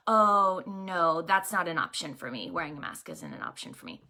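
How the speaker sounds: low, softening polite refusal; calm, confident, kind. Retold or imitated. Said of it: "I say it very calmly, very confidently, and very kindly".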